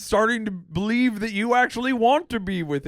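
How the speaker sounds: Ray Romano impression. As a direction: whine at the wife about Bowser. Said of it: "I can't do Ray".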